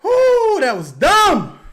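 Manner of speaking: loud high whoop then a slow disgusted verdict; exasperated outburst. Real, not acted. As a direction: vent the frustration at full volume. Outburst at a bad play.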